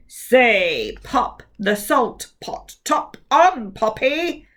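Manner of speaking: slow, commanding mouth voice; ordering. Acted. Giant mouth orders Kovac to try. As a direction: lay the twister down like an order. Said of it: "ordered the mouth".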